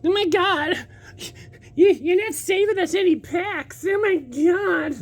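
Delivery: mocking whiny commenter. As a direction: squeal like an annoyed fan complaining about packs. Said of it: "the comments ... they're like".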